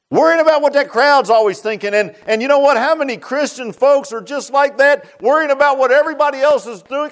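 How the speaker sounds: high, loud preaching cadence; fiery sermon climax; full-force preaching. Acted. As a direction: drive it home to the congregation.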